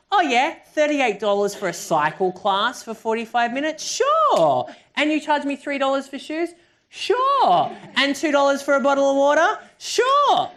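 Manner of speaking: high, loud, gushing mock enthusiasm; questions climbing, each "Sure" a big high whoop; eagerly agreeing customer. SoulCycle customer happily accepting every price, exaggerated eager agreement.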